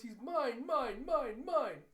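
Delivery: high, loud wail stretched on each word; possessive outburst. Retold or imitated. Retelling a character claiming him for herself, possessive outburst. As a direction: cry it out as a child clinging on.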